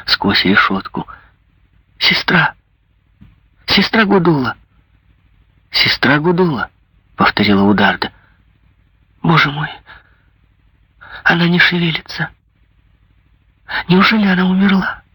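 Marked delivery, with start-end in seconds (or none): 2.0-6.7 high anxious calling. A narrator's reading. 9.2-15.0 high, rising alarmed cry; panicked, getting faster. A narrator's reading. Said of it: "продолжала Жервеза".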